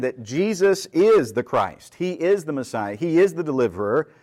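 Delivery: insistent repeated stress on every "is"; very emphatic. Retold or imitated. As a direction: punch "is" in each of "Jesus is", "He is", "He is". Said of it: "he is saying something very emphatic".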